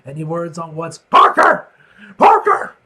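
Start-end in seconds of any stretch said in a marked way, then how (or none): 2.2-2.7 very high, loud yell of a name; boss bellowing. Acted. Grumpy editor yells loudly for his photographer. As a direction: roar the name across the office.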